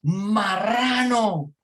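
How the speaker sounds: high, long-drawn yell; shouted retort. Retold or imitated. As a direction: yell the insult back out of a car window. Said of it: "le grita al carro".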